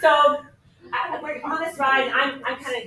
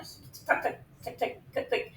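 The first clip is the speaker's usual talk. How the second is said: quiet, voiceless tick sounds; soft mechanical clicking with the mouth; ticking gears; 10 dB quieter, slower than usual. Retold or imitated. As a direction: imitate ratcheting gears, hushed. Imitates the ride's gears clicking.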